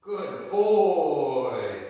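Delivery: reward the dog with big cheer; loud happy dog praise; excited praise. Real, not acted. Praising dog after recall.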